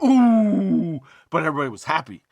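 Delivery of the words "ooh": high, loud crowd gasp of ooh. Retold or imitated. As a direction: react like a stunned audience. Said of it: "Everybody was like".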